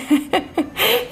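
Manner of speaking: a short, high, giddy laugh; real laugh of emotion; emotional laugh. Real, not acted. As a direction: laugh it off, choked up. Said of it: "Eu fiquei emocionada".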